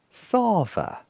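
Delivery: high, falling little voice; small-creature astonishment; Basil, taken aback. A narrator's reading. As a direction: echo it in dawning disbelief. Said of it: "said Basil slowly".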